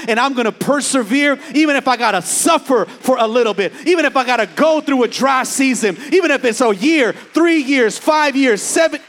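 high, loud, building sermon cry; preaching at full force. Acted. Pastor rallying the congregation, high and loud preaching. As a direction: shout it to the rafters like a revival.

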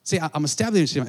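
ordinary talk, nothing marked

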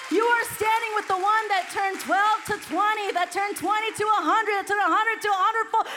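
loud, high, rising sermon climax; loud, high revival preaching; preaching at full force. Acted. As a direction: build the crowd up with each line.

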